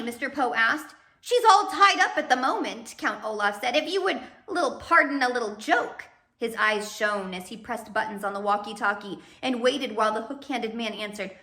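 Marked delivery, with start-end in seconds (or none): 1.2-6.1 mocking villain. Olaf mocking joke.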